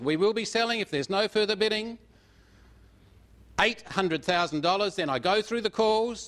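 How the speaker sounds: auctioneer calling the final bids; auction bid spotter. Real, not acted.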